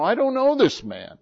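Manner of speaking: high, loud mock curse; angry denial. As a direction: snap it out like a cornered man denying. Peter cursing, denying Jesus.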